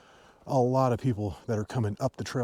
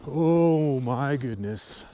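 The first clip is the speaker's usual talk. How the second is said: slow awed gasp of words; drawn-out amazement; surprised; much slower than usual. Real, not acted. Snow gives way nearby.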